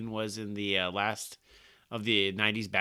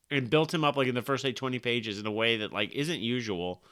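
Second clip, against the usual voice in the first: ordinary delivery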